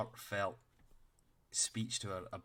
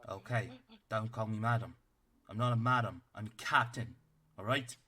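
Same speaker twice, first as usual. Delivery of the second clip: a tiny officer barking orders at the party; stern little captain; 2 semitones higher, 6 dB louder than usual. Acted. Captain corrects the paladin.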